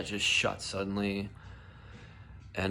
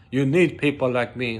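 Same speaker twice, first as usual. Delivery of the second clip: loud, raised, defiant declaration; Scarface-style outburst; 4 semitones higher, 8 dB louder than usual. Retold or imitated. Worker quoting his Scarface moment, loud and defiant. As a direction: declare it like Tony Montana. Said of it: "That dinner scene in Scarface, I felt like that".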